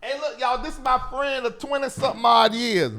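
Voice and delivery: high, loud, eager welcome; excited introduction. Real, not acted. Host introducing his friend of twenty years to viewers, high and excited. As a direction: hype up the friend for the audience.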